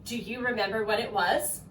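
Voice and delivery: normal talking voice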